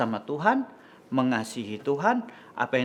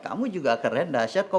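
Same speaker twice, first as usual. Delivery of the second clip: plain reading voice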